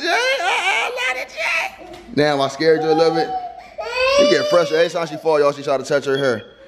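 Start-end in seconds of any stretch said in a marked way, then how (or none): 0.1-2.0 high sing-song uh-oh. Real, not acted. Mom soothing her baby after a fall, high sing-song.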